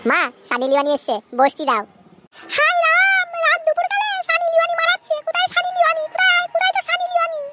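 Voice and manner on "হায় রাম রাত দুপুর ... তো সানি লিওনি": very high-pitched cartoon mother, irritated; high put-on mother voice; scolding mother. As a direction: scold the boy in a squeaky, high mum voice. Skit mother reacts to boy's dream.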